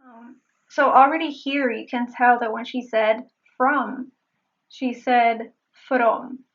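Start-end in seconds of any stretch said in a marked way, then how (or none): 4.7-6.4 from with a tapped Spanish r; slow from with a rolled r. Shown as a demonstration. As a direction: tap the r in from like Spanish. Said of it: "She was using the Spanish R".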